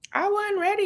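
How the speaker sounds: playful high wasn't ready; sassy catchphrase. Pretend. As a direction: toss it off as a cheeky catchphrase.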